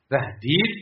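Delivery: usual speaking voice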